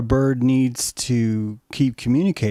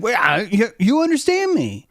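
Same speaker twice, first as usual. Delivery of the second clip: much higher, louder stammered amazement; astonished question; 11 semitones higher, 4 dB louder than usual. Retold or imitated. Bird overwhelmed by the connection, stammering high amazement. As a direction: stammer in delighted disbelief.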